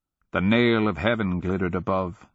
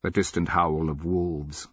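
ordinary delivery, nothing marked

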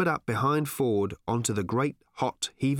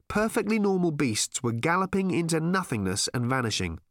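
plain reading voice

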